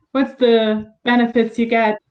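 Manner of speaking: ordinary talk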